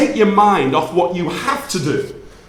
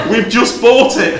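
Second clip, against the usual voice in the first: laughing reveal; 5 semitones higher, 6 dB louder than usual. Retold or imitated.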